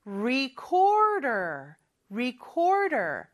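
recorder said very slowly, clear R at the start; careful English R. Shown as a demonstration. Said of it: "R 발음을 주의해야".

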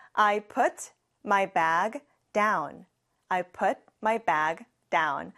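"I put my bag down" said word by word; very slow model. Shown as a demonstration. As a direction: model the sentence slowly for repeating. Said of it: "같이 해볼까요". (따라 하도록 아주 천천히)

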